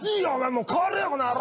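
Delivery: fast, high angry brush-off; mock angry dismissal. Old man sending the masseuse away, fast and annoyed. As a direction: shoo her out, fed up.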